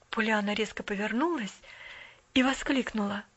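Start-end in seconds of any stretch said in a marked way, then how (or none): none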